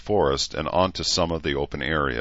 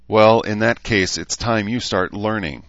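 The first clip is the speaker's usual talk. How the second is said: louder opening, reined-in irritation; controlled temper; 6 semitones higher, 6 dB louder than usual. A narrator's reading. Mother controlling her temper with her daughter, reined-in irritation. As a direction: keep the temper just under the lid. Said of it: "after a moment of controlling her temper".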